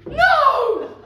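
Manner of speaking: loud, high scream of horror. A narrator's reading. Prince seeing the slipper fit the ugly sister, screaming. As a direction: scream it out in dread. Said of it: "The prince screamed".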